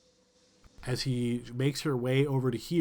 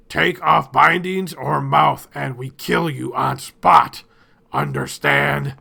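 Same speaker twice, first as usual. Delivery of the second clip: much louder, slow, menacing warning; masked leader threatening the gagged prisoners, loud and slow; loud slow threat; 11 dB louder, slower than usual. Acted. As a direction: bark the threat word by word.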